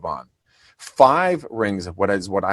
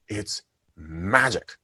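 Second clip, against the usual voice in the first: usual speaking voice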